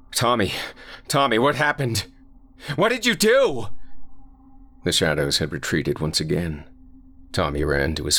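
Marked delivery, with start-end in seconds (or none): 0.1-3.7 high, rising frantic dad; panicked father. Acted. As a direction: demand answers in panic. Father panicking over body.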